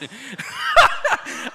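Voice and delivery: high, loud burst of laughter; loud cackling laugh; big laugh. Real, not acted. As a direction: burst out laughing at your own joke. Laughs at his own joke.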